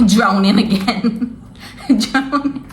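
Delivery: laughing through the words, drawn out; real laughter breaking up the words. Real, not acted. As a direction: crack up in disbelief while saying it. Laughs at own second drowning.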